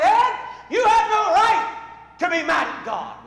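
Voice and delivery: high, loud rebuke, a little slow; scolding shout. Retold or imitated. Pastor rebuking Mad Dog in the office, loud scolding. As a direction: shout him down, finger in his chest.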